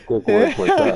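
high, loud laugh through the words. Real, not acted. Player to teasing friend about breaking up, laughing protest. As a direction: laugh off the accusation.

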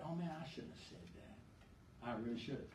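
whispered, rueful self-reproach; regretful whisper. Retold or imitated. Someone regretting ugly words to a spouse, whispered. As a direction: breathe it like a private regret.

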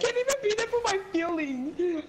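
mock emotional whine. Lamenting feelings mid-game, hammed-up whine. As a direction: wail it with hammed-up self-pity.

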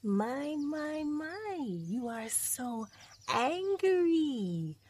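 high, slow, drawn-out taunt; mock fear. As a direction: taunt the wolf with exaggerated, stretched words. Lion mocks angry wolf.

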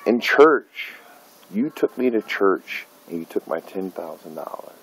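normal talking voice

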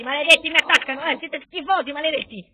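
loud, high outraged cursing; angry outburst. Pretend. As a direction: yell indignantly at the attacking enemy. Rants at attacking enemy troops.

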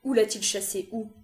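restrained last desperate plea; pleading. A narrator's reading. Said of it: "Elle supplia une dernière fois".

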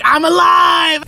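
mock ecstatic shout. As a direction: shout it like confetti just hit you. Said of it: "you're like".

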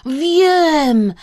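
loud, high exclamation; Kresek calling out. A narrator's reading. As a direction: call it out with a sudden idea. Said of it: "zawołał Kresek".

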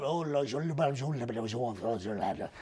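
a lightly done parody French murmur; mock French accent. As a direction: nonsense French sounds sung out low to mock a charmer. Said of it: "he's got that French accent".

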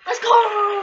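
loud high yell of triumph; excited shout. Real, not acted. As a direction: cheer it out. Celebrating a point.

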